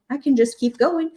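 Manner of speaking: ordinary talk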